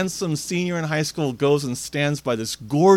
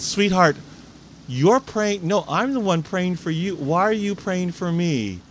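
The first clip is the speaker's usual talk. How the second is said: higher-pitched, bewildered questioning, acted; astonished; 5 semitones higher than usual. Hospital patient learns the boy prays for her, astonished. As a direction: imitate her puzzled astonishment, pitch raised. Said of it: "Janet was astonished".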